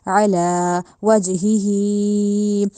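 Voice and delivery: वजहिही with the jim bounced in qalqala; slow recitation. Shown as a demonstration. Tajweed teacher models qalqala, very slow chant. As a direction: chant वजहिही slowly, jolting the j. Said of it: "उसके ऊपर हमने कलकला करना है".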